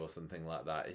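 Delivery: ordinary talk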